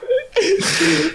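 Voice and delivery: high, helpless laughter; real laugh. Real, not acted. Host cracks up with co-host over muddled names, high helpless laugh.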